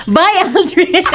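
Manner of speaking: high farewell breaking into laughter; laughing goodbye. Real, not acted. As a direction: call goodbye and burst out laughing. (patawang paalam na mataas)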